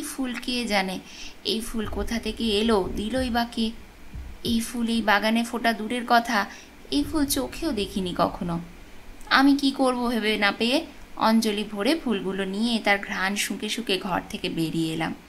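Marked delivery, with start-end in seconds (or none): none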